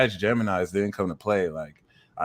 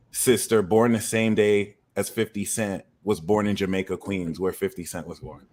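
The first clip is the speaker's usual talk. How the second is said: ordinary talk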